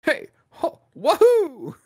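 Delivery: high, loud, cheery plumber cry; Mario voice. Retold or imitated. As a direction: belt it out like the video game plumber. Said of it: "Chris Pratt trying to do an Italian accent".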